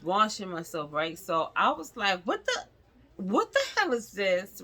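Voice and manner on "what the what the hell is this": high shocked cry, pitch jumping way up; shocked discovery. Retold or imitated. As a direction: gasp it out as you spot something wrong. Finding a lump in shower.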